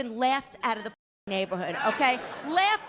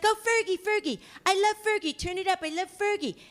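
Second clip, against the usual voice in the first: put-on high, excited teen gushing; daughter gushing; 7 semitones higher than usual. Daughter in the car gushing over [name] on the radio. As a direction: squeal it like a thrilled teenager. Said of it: "And she's like".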